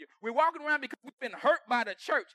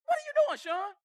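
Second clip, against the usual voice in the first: scolding himself; 4 semitones higher than usual. Retold or imitated. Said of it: "I have to talk to myself".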